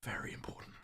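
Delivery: soft mock-serious whisper. Streamer calming down after yelling, whispering. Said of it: "Deep breathing techniques".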